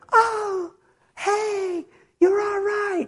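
very high, drawn-out mock wifely greeting; imitated wife. Husband imitating his wife after the accident, high-pitched relief. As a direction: pitch it way up as a fond impersonation.

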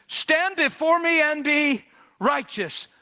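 high, slow, forceful proclamation; commanding voice of God. Acted. God commanding Abraham to stand before him, high and emphatic.

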